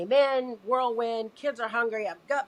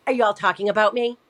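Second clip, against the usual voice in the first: nasty; 2 semitones higher, 7 dB louder, faster than usual. Retold or imitated. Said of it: "just real nasty".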